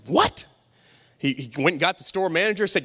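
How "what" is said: high-pitched, rising what; acted disbelief at an insult; can't believe what you just heard; incredulous. Acted shocked reply to parrot's insult.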